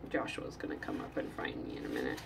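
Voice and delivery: low hushed whisper aside. Real, not acted. Warning before child arrives.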